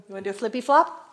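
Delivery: usual speaking voice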